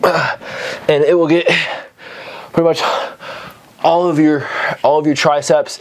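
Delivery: strained, effortful speech during a hard rep; straining mid-exercise. Real, not acted. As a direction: talk through the strain of the hold. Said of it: "I'm fucking shaking right now".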